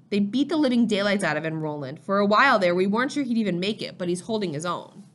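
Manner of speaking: plain reading voice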